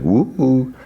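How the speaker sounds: high-pitched retold woo-hoo; delighted whoop. Delighted reaction to a gift.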